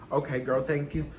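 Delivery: ordinary delivery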